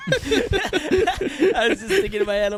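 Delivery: high, slow words through real laughter; can barely get it out for laughing. Real, not acted. Cracking up mid-sentence.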